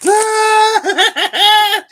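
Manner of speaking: loud high laugh; burst of laughter. Real, not acted. Laughing at Sandra's question, loud and high. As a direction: crack up at the question. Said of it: "are bees that significant".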